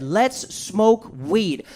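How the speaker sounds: very slow drawn-out offer; slow lazy invitation; slow stoner invite. Retold or imitated. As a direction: savour each word slowly. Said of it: "He said".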